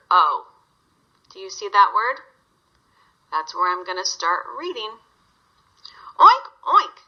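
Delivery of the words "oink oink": high, loud oinks; loud high pig oinks; pig squeal. Acted. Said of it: "the mama pig squealed".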